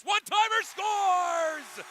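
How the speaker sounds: drawn-out shouted goal call. Real, not acted. Goal call in hockey broadcast.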